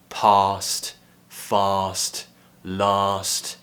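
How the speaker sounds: "past, fast, last" with long open ah, slow; RP long-a words. Shown as a demonstration. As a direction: slowly say "past" with long back ah. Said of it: "It would be the second option".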